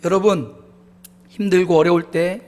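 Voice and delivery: ordinary delivery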